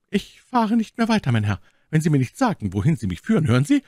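hoch, lauter und schnell, empört; empörte Mylady. A narrator's reading. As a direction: als empörte Lady fordernd drängen. Voiced Milady. (Mylady verlangt vom Offizier eine Antwort, empört und laut)

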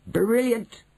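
high-pitched burst of delight; delighted cry. A narrator's reading. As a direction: cry out in sudden excitement. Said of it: "my grandmother cried out".

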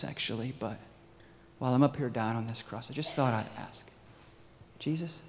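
soft, hesitant request with pauses; tentative, unassuming acted appeal; humble plea. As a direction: ask meekly, trailing away quietly. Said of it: "Can't you imagine being him up on the cross?".

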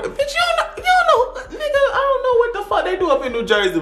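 mock him while cracking up; laughing rant.

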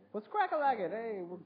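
high, loud, pumped-up hello; hyped greeting. Real, not acted. Vlogger greeting his viewers, high and hyped. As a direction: burst in like you're hosting a show.

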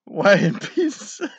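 high and loud, slowly through giggles; giggling over the words; laughing. Real, not acted. Reading the joke's punchline to viewers, giggling.

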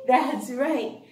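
normal talking voice

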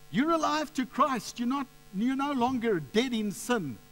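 high, ringing proclamation; impassioned sermon climax; fervent preaching. Real, not acted. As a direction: declare it triumphantly to the congregation.

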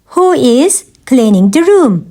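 measured language-lesson delivery; careful teaching repeat. Shown as a demonstration. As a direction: pause after "Who is". Teacher repeats question for learners.

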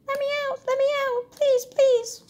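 very high, squeaky pleading cries; pleading caged animals. Acted. Notes on the page plead like caged animals. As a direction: beg to be let out, small and trapped.

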